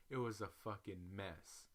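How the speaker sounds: ordinary delivery, nothing marked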